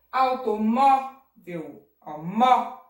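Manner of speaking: Automóvel slow, MÓ long and loud; stress on MÓ. Shown as a demonstration. Teacher isolating the strong syllable, loud MÓ in Automóvel. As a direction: punch open MÓ in Automóvel, then repeat it. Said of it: "acento agudo".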